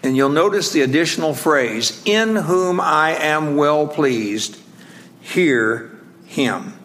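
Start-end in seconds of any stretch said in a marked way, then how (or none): 2.0-6.7 slow solemn reading. Shown as a demonstration. Reads the divine words slowly.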